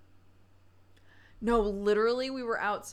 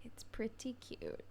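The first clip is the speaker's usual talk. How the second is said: a much softer, higher "It's pretty cute"; 4 semitones higher, 12 dB quieter than usual. Real, not acted. Repeating a fond remark about a child, much softer. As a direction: murmur it fondly to yourself.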